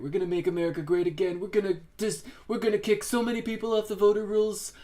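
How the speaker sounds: loud, high mock Trump rally voice; Trump impression. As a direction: ham up Trump bragging to a crowd. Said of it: "Trump was doing the whole big lie stuff".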